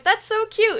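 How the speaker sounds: high, loud gushing. Retold or imitated. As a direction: coo over it. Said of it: "I was like".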